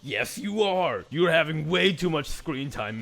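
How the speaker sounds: loud, slow, pointed jab; loud accusation. Real, not acted. Player shouting down the suspect on screen, loud. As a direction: nail her with it, loud and drawn out.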